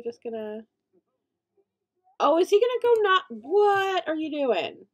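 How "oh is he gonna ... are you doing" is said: loud, high outraged cry; shocked, indignant shout. Real, not acted. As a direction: protest in disbelief, stretching the end. Visitor kicks over her trash can.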